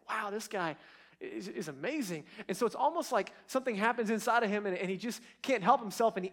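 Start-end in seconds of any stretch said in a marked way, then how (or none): 0.1-2.2 hushed, softer wow of amazement; softly marveling, with a small stammer; captivated awe. Retold or imitated. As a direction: voice a man quietly blown away. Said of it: "seemed to be captivated".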